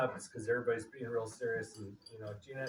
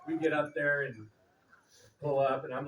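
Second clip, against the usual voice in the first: ordinary talk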